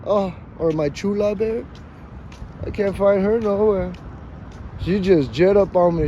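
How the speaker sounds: normal talking voice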